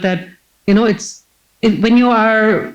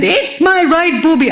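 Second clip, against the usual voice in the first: patient on a trolley yelling her point down the corridor; frantic yelling; 8 semitones higher than usual. Retold or imitated. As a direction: yell it high and stretched like a panicked patient. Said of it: "I wasn't giving up".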